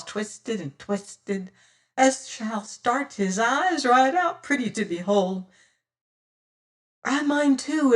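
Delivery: slow, high pirate voice savouring cruelty; high-pitched voice. Acted. Fat boatswain's mate offering tortures to his captain, high and gloating. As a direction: gloat over each torture like a treat. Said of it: "says he in his high-pitched voice".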